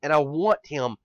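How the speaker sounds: ordinary delivery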